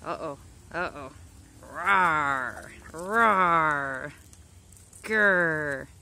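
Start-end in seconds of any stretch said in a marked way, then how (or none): 1.6-4.1 loud hammed-up dinosaur roar. Voicing the attacking duck as a loud mock dinosaur. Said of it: "put some dinosaur sound effects". 5.0-5.9 loud mock growl; playful growl. Growling back at the attacking duck, loud mock growl.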